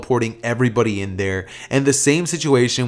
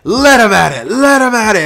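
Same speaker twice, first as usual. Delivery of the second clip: loud, high, drawn-out fight heckling; maniacal cheering; 7 semitones higher, 8 dB louder, slower than usual. Acted. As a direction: egg the fighters on like a gleeful ringside fan. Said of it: "clap and laugh maniacally as he would say".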